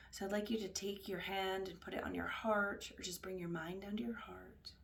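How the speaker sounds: hushed, unhurried guiding voice; guided meditation. Acted. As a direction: guide them gently inward, leaving space between phrases.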